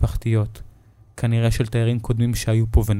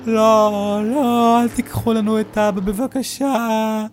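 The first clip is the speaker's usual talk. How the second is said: put-on high, desperate pleading girls' voice, very slow at the end; pleading girls; 11 semitones higher, much slower than usual. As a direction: beg through mock tears. Girls running after the car crying.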